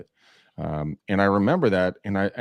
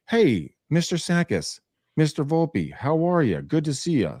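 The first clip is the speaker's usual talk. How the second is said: polite cheery greeting; 7 semitones higher than usual. Retold or imitated. Welcoming older clients with a polite higher cheery hello. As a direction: greet them like a courteous salesman.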